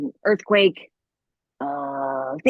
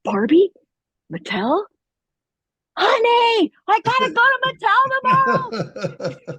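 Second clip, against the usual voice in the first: excited; 3 dB louder, slower than usual. Retold or imitated. Telling husband about the callback.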